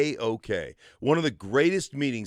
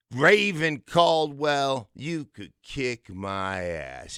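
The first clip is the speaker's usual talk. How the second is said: slow pointed callout; much slower than usual. Retold or imitated. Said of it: "he goes".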